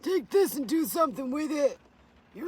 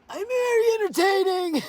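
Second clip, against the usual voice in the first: higher, louder, put-on showy voice; 8 semitones higher, 6 dB louder than usual. Streamer showing chat her put-on voice, higher and louder. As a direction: ham it up in a bold put-on voice.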